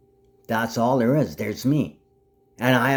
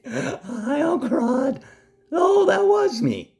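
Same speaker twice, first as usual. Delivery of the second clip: very high, teasing squeal; high mock greeting, drawn out at the end; mocking put-on voice; 4 dB louder, slower than usual. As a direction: tease in a squeaky falsetto.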